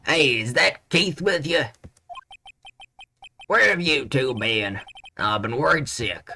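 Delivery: put-on lightly Southern old man; worried Southern grandpa; Southern accent. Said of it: "I made him southern".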